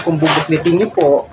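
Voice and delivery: ordinary talk, nothing marked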